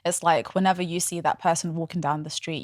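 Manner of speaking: ordinary talk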